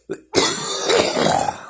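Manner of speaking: another choking cough; coughing fit. Real, not acted. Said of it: "I'm choking on coffee".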